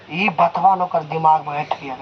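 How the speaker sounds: ordinary delivery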